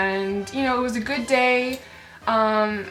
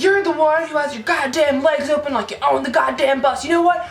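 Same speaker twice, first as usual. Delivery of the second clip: imitated angry tirade, higher at first; mock screaming; 3 semitones higher, 3 dB louder than usual. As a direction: rant like an irate man on a bus. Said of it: "he starts screaming".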